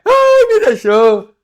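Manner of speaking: high, slow, hammed-up lament; mock whining. Preacher mocks a woman crying over her ex-husband, high hammed-up whine. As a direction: wail it like a jilted wife, mocking.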